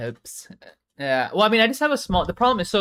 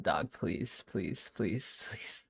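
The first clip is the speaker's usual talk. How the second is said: softer and softer begging please; pleading with dog; 5 semitones lower, 11 dB quieter, much slower than usual. Real, not acted. Streamer quietly pleading with his dog. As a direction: beg the dog under your breath.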